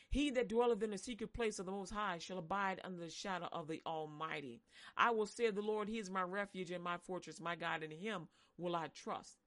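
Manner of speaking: low, measured recitation of scripture; scripture reading. Acted. Preacher reciting Psalm ninety-one to the congregation.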